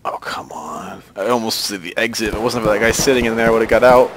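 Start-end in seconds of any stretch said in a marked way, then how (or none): 0.0-1.0 whispered groan of defeat; frustrated whisper. Real, not acted. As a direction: sigh it out under your breath. Missed the exit.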